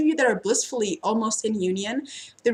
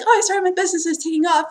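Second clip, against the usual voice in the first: excited client; 8 semitones higher, 5 dB louder, much faster than usual. Retold or imitated. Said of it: "Or they come to me and be like".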